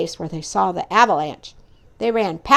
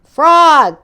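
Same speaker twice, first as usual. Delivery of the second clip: high loud call; loud high hail; 6 semitones higher, 11 dB louder than usual. Acted. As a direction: call across the stage. Toad calls out boastfully.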